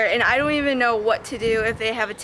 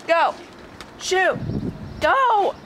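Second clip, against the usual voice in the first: high, raised shoo; shooing the dog; 6 semitones higher, slower than usual. Real, not acted. Owner shooing her dog out of the room, high and raised. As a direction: chase the dog off.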